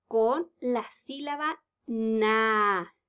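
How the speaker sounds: very slow, na stressed at the end; stressing the syllable. Shown as a demonstration. Teacher setting the task, slow and stressed. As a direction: lean on the last syllable. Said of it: "teacher stresses target syllable".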